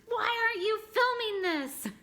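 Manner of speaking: high, loud squeal at husband; shocked yelp at husband for not filming; shocked outburst. Retold or imitated. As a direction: shriek it at him mid-shock. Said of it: "I looked at my husband and I said".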